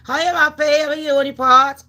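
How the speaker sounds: loud, high, gushing hello to a friend; excited greeting. Real, not acted. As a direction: greet her like a long-lost sis, loud and bright. Greets a viewer by name.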